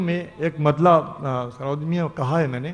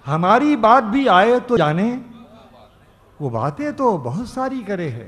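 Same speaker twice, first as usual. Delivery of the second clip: declaiming a ghazal couplet; ghazal recitation; 5 semitones higher, 4 dB louder than usual. Acted. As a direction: recite each line like poetry on stage. Poet recites verse at mushaira.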